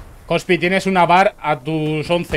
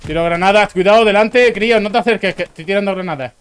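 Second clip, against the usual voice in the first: shouted warning to teammates; grenade warning yell; 6 semitones higher, 7 dB louder than usual. Real, not acted. Warning squad about thrown grenades.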